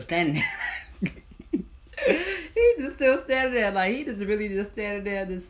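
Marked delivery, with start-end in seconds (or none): 1.2-2.5 high laugh. Real, not acted. Laughing while recounting. 2.5-5.5 high, loud, talking through laughter; laughing. Real, not acted.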